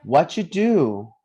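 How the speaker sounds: "What you do" drawn out slowly as "what-ju-do"; teacher's slow, loud model of "What you do"; "What you do" in slow linked sounds for repeating; pronunciation model. Shown as a demonstration. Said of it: "let's pronounce it together".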